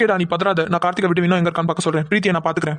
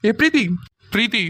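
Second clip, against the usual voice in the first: raised-pitch call of a name; 4 semitones higher than usual. Acted. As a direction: call her to snap her out of it. Calling out to the frightened girl.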